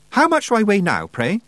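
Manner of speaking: a high, proper schoolmistress asking sharply; lightly voiced teacherly witch; prim witch voice. A narrator's reading. Miss Tick questions the coachman.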